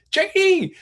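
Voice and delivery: high, loud imitated holler; excited call. Surgeon sliding into the hall to his best friend, excited holler.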